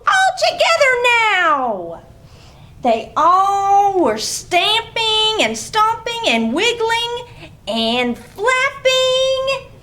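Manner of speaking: put-on bright read-aloud for small kids; lively story reading. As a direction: read it like a playful picture-book story time. Reading picture book aloud.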